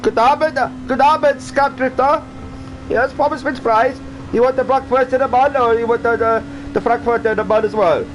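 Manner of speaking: high, hammed-up fake German accent; jokey put-on German accent greeting drivers; crap German accent. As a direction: play a cheesy German sausage seller with a bad German accent. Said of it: "That was a crap German accent".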